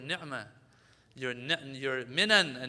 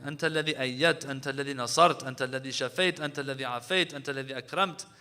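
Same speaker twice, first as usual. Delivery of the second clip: quick, rhythmic, louder Arabic supplication; rapid dua recitation; 7 dB louder, much faster than usual. Acted. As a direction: roll through the praises fast and fervent.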